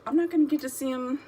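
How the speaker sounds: high, hurried fretting; distraught worry. Retold or imitated. Worrying aloud during the movie, high and fretful. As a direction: fret as if about to cry. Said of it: "And I was like".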